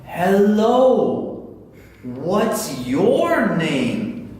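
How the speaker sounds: loud, very stretched-out words; mock over-slow teaching voice; exaggeratedly slow. Shown as a demonstration. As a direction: talk down to a child who can't understand. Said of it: "If you talk to your child like that".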